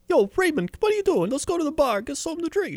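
high-pitched sitcom buddy; Ray Romano's friend. Acted. Friend inviting Raymond to the bar, high cartoonish sitcom voice. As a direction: call out like the pal coaxing him along. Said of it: "one of Ray Romano's friends".

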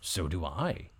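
low, soft donkey. Acted. Donkey agreeing with the rooster, low and soft. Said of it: "answered the donkey".

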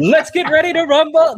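loud, high hammed-up announcer call; ring announcer. Host jokingly kicks off a two-guest show, hammed-up announcer shout. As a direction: belt it out like a mock fight announcer.

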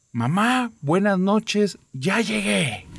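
high, calling out to the dead; shouted greeting. Retold or imitated. Girl calling into the cemetery, high shouted greeting. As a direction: call it out into the graveyard, high-pitched. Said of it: "Entonces grita".

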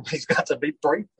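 repeating it through a laugh. Real, not acted. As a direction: echo the line while chuckling. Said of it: "repeats S0's line laughing".

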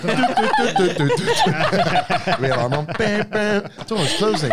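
high, loud, laughing lift noises; laughing mimicry. Retelling the lift ride, high laughing mimicry. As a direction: giggle through the button sounds.

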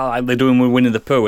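ordinary delivery

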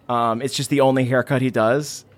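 usual speaking voice

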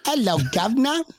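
high-pitched, hammed-up London greeting; mock London accent. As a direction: put on a joke London accent. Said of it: "you guys sound like you're from London".

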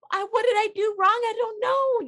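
put-on high, fast, panicked child's voice; frantic toddler. Toddler yelled at by a parent, high and frantic. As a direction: cry out like a scared little kid.

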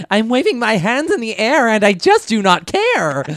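high, loud cartoon alien. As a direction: belt it like a goofy cartoon alien. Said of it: "You sound like the alien".

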